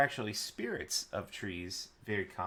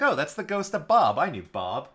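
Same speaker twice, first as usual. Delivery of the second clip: excited recognition; 5 semitones higher, 11 dB louder than usual. Retold or imitated. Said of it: "be like".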